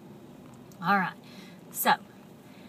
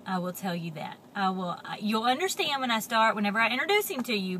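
ordinary delivery, nothing marked

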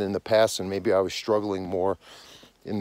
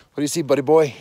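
higher, fond dog-talk voice; 5 semitones higher, 4 dB louder, faster than usual. Real, not acted. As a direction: coax the dog in a higher, friendly tone. Talking fondly to his dog.

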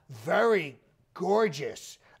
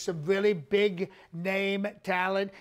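usual speaking voice

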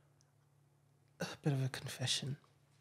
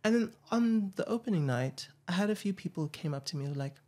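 usual speaking voice

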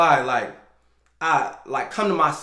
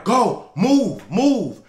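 higher, louder barked order; commanding shout; 7 semitones higher, 5 dB louder, much slower than usual. Retold or imitated. Man ordering the woman from his door, loud command. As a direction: order her off, firmly.

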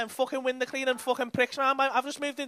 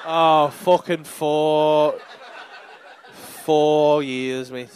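loud, low, drawn-out mock misery; mock unhappy; 9 semitones lower, 5 dB louder, much slower than usual. Comedian mimics Rich's unhappy answer, mock miserable drawl. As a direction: drag it out slowly, hamming up the unhappiness. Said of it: "you don't sound happy there".

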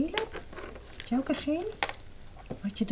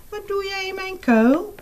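squirrel voice; 13 semitones higher than usual. Acted.

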